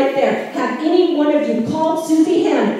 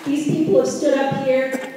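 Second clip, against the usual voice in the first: usual speaking voice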